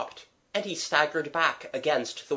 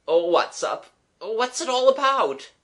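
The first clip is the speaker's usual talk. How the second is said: loud, high, distressed questions; bewildered gasp; 11 semitones higher, 6 dB louder than usual. Acted. Shaken young man begging the children to explain, distressed. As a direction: climb higher in bewilderment. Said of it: "he gasped feebly".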